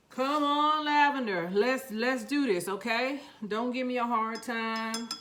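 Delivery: pep-talk the seeds like a coach; loud coaxing. Real, not acted. Coaxing the lavender seeds to grow.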